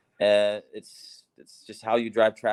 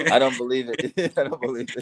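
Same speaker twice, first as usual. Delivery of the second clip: laughing disbelief; 4 semitones higher, 4 dB louder than usual. Real, not acted.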